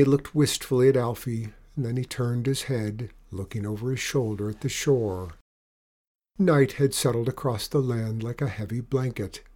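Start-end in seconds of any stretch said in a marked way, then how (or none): none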